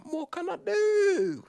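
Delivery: high, loud and drawn out; exasperated cry. Retold or imitated. Telling the staff he has done all he can, high exasperated cry. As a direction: plead helplessly, stretching it.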